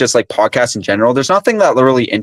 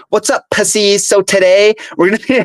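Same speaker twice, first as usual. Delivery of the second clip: higher, bouncy mock host greeting; imitating the podcast host; 8 semitones higher than usual. Mocking the host's opening to his friend, higher and bouncy. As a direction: ham up a chirpy podcast intro. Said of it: "the first thing this guy says is".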